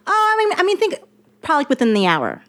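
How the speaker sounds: mocking the writer's casual answer; high mock writer. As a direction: play the writer's breezy excuse. Imitating the writer's excuse.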